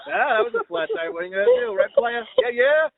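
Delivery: high, buddy-buddy player talk; bro-ish joke. Retold or imitated. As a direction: slap a stranger on the back with your voice. Bro-joking with stranger after rejection.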